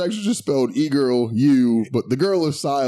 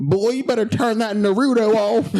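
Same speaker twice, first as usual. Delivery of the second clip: higher, laughing Southern parent voice; Southern accent; 7 semitones higher than usual. Pretend. Showing off his Southern accent to his friend, laughing country scold. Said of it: "maybe you do have a good accent".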